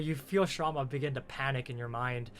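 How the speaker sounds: usual speaking voice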